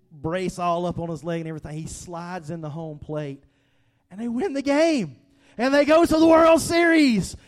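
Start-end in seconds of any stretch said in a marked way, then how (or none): 4.1-7.4 louder and higher, reliving the win; triumphant. Retold or imitated. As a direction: call it out like the crowd erupting. Recalling the winning run.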